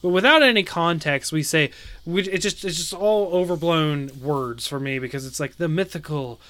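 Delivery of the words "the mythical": soft, airy, mock-epic breathy voice. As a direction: breathe the name out like a grand legend. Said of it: "making fun of, like, breathy voice".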